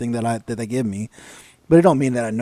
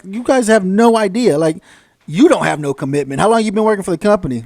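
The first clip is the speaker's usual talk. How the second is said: scornful lecture; 5 semitones higher, 5 dB louder than usual. Retold or imitated. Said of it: "I like".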